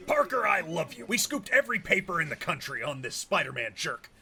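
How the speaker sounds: ordinary talk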